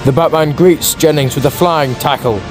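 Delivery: usual speaking voice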